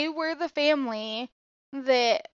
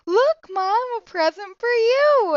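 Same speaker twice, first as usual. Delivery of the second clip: put-on higher, louder eager child; mock-excited kid, higher and louder; 9 semitones higher, 6 dB louder than usual. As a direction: show off the gift to mom. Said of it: "I'm like".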